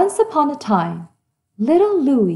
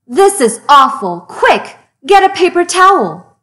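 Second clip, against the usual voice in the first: higher, louder hurried alarm; panicked urgency; 5 semitones higher, 6 dB louder than usual. Acted. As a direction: call for help to wipe the mess. Panicked clean-up.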